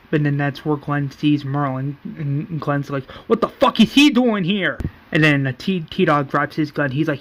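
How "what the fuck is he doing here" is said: higher-pitched indignant outburst; retold outrage. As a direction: demand an answer, acting out someone's alarm. Retold outrage on seeing Merle.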